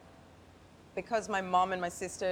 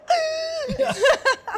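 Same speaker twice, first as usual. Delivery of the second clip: much higher, louder shrieking cackle; mocking laughter; 11 dB louder than usual. Old men laughing at her challenge, shrieking cackle. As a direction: burst into a high shrieking laugh. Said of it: "they'd just be like".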